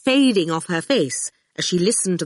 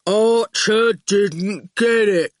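very slow stubborn goon; 3 semitones higher, 4 dB louder, much slower than usual. A narrator's reading. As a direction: stress Archer, dragged out heavily. Goon repeats himself.

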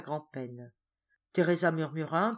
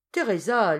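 a loud, higher call of the name; mother calling; 5 semitones higher, 10 dB louder than usual. A narrator's reading. Mother calling Teresa away from the beggars, loud call. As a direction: shout her name to fetch her. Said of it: "lui cria encore sa mère".

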